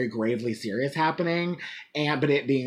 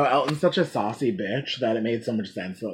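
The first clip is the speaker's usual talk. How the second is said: usual speaking voice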